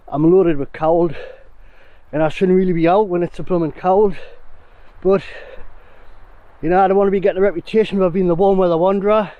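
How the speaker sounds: ordinary talk, nothing marked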